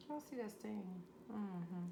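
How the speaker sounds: soft, hushed repeat of the question; quiet. Acted. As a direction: drop to a near hush. Showing off finished dish, repeated.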